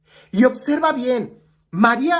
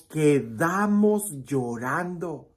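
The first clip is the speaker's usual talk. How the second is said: drawn out, weight on "quedamos llorando"; slow emphasis; 5 semitones lower, 4 dB quieter, much slower than usual. Real, not acted. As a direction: lean hard on "quedamos", slowly. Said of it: "En lo que sí estoy poniendo el énfasis". (énfasis lento en "quedamos llorando")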